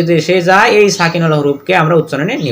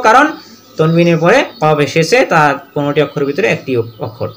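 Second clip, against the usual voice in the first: ordinary talk, nothing marked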